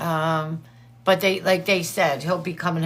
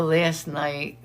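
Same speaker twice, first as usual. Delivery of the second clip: normal talking voice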